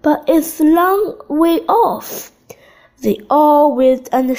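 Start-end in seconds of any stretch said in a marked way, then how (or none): 0.0-2.3 lightly raised, higher call; mild calling voice in narration. A narrator's reading. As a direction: call out with pitch lifted. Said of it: "he called".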